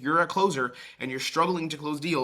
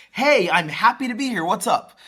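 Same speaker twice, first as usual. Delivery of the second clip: much higher and louder, too eager; overeager; 8 semitones higher, 10 dB louder than usual. Shown as a demonstration. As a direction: burst in like an overexcited salesman. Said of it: "Overeager is like".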